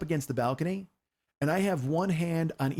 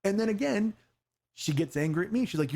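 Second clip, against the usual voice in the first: ordinary delivery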